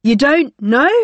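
loud incredulous shout, rising. Acted. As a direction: shout over the alarm noise. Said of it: "shouted Semelia above the noise".

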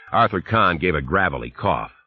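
usual speaking voice

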